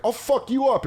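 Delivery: high-pitched retold threat; mock threat. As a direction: puff up like an online tough guy. Retold threat to online trash-talker.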